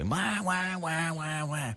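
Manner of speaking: high mock baby wail; wah-wah like a crying baby; imitated baby crying. Dad mimicking his crying baby son. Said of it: "Matthew had been crying the whole day".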